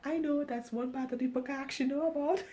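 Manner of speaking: high, quick, soft aside; amused aside. Real, not acted. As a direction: break off reading with a high, quick comment. Amused aside, mocking own repetition.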